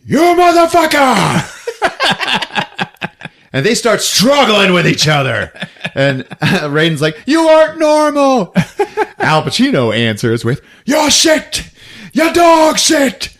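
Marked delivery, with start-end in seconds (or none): 0.0-1.4 high, loud mock-furious scream; hammed-up enraged yell; retold yell. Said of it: "busts in already yelling". 7.3-8.5 high, loud, hammed-up protest; mock-outraged high shout; acted fight yell. Retold fight, character yelling. 10.9-13.4 high, drawn-out hammed-up yell; over-the-top Pacino-style outburst; mock raging insults. Retold fight, Pacino answering.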